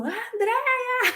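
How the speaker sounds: retold shout of a name. Coworker calling her across the airport parking lot. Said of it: "ela gritou".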